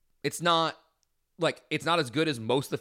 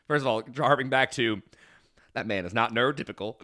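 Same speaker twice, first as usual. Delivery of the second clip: ordinary talk, nothing marked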